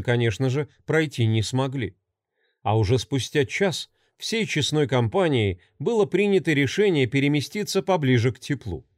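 usual speaking voice

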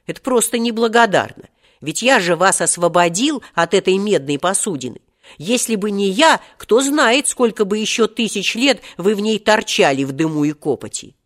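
indignant. A narrator's reading. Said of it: "возмутился Серёжа". (возмущённый протест)

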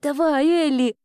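put-on high girl's urging; self-encouragement. A narrator's reading. Girl cheering herself on while carving, urging. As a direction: push yourself on in a child's voice. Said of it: "подбадривала она сама себя".

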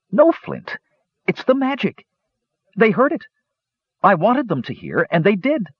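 high, eager kender voice. A narrator's reading. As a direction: explain the magic to Flint with wonder. Tass explaining the magic eagerly.

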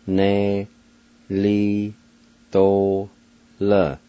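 "Go, he, gi, ya" and "nu, na, da, ne, li, to, la" each given its own beat with pauses between; syllable by syllable. Shown as a demonstration. Teacher breaking a two-part word into syllables. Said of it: "First part is pronounced like this".

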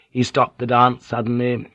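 ordinary delivery, nothing marked

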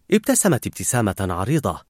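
plain reading voice

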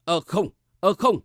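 halting, lightly stammered denial; tongue-tied. A narrator's reading. Old servant caught out by the question, mildly tongue-tied. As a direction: fumble for words, a touch flustered. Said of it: "há miệng líu lưỡi hồi lâu".

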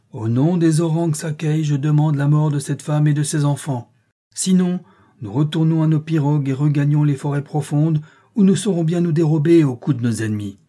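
high, loud, sustained declamation; shrill voice. A narrator's reading. Sorcerer demands the woman's death, shrill voice. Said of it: "D'une voix aiguë, Igliotu répliqua".

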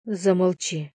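plain reading voice

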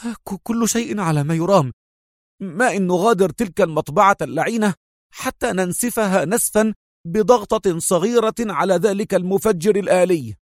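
high, excited voice with a stumbling start; lightly acted breathlessness, pitched high; panting Magdy. A narrator's reading. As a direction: report in while catching your breath. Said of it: "هتف مجدي وهو يلهث".

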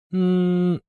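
high, held hum; thoughtful hum. A narrator's reading. Yulia pondering the clue, thoughtful humming. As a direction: hum while searching for an answer. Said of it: "Юля задумалась".

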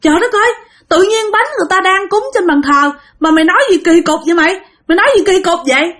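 put-on high, fast scolding child. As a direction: scold her friend in shock. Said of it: "bạn la nó".

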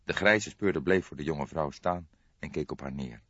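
ordinary delivery

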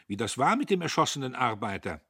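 like a petty official stressing his duty; self-important. A narrator's reading. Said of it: "wichtig wie ein kleiner Beamter".

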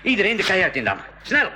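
barked urgent command; urgent shout. Acted. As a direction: get everyone below deck now. Darts fired, urgent order inside.

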